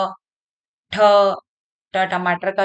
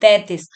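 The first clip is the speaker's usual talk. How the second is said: तैंतीस said louder, firm falling answer; answering own question; 5 dB louder than usual. Shown as a demonstration. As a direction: answer तैंतीस firmly like a teacher. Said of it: "कितनी है?".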